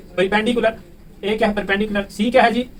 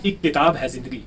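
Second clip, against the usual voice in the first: a teacher reciting a poem before class; poem recitation; 4 semitones lower than usual. Acted. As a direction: recite it like a couplet at a gathering.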